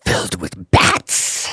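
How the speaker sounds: slow hushed whisper; whispered horror-movie line; spooky whisper. Acted. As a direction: creep it out. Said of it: "spooky follow-up".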